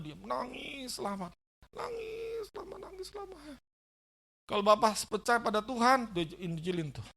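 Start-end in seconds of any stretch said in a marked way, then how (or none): 1.7-3.4 high, tearful mock crying; imitating weeping. Preacher acting out the sick father's long weeping, tearful. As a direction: let the voice break like an old man weeping. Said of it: "Si bapaknya nangis".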